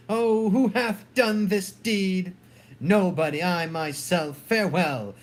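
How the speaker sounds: normal talking voice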